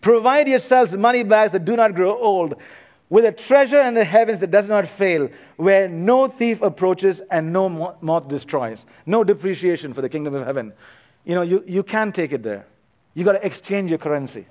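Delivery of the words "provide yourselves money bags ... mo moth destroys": raised, declaiming reading of scripture. Acted. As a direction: proclaim the verse to the congregation, a touch higher. Reads scripture passage aloud.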